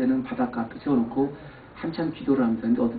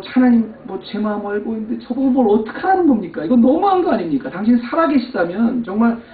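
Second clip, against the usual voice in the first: raised, louder reproachful plea; complaining to God; 8 semitones higher, 6 dB louder than usual. Retold or imitated. Pastor retelling his resentful prayer, raised reproachful voice. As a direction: demand an answer from God, a bit raised. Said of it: "막 원망을 했어요".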